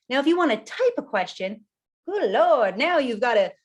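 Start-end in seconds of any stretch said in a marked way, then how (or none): none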